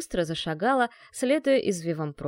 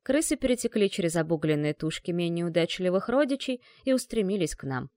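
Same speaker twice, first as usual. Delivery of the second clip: plain reading voice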